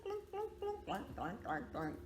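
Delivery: plain reading voice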